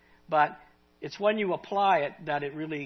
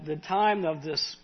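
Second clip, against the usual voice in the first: ordinary talk, nothing marked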